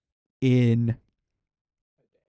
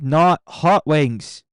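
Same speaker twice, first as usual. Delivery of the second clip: not hot wings said very slowly, not hit hard; emphatic, slow; 3 semitones higher, 7 dB louder, much slower than usual. Real, not acted. Streamer repeating to chat that hot wings are out, slow and insistent. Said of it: "I said".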